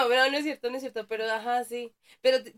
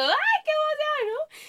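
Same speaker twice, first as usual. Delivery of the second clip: much higher, slow squeal of delight; mock excitement; 7 dB louder, slower than usual. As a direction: gush like a thrilled fan. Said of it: "y yo digo". (emoción exagerada, muy aguda y lenta)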